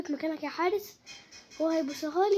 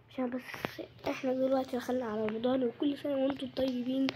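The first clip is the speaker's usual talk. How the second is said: normal talking voice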